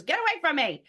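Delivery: imitating a daughter, high and loud; loud mock rejection, pitch jumping up; daughter's outburst. Daughter pushing mom off mid-hug, mock outburst. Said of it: "she goes".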